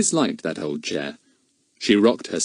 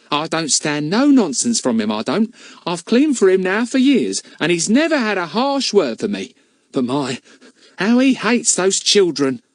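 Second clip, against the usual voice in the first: much higher, louder country gossip; a cockney charwoman gossiping, higher and louder; cleaning woman's voice; 8 semitones higher, 3 dB louder than usual. Acted. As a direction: warn her knowingly. Mrs Cockles chatting.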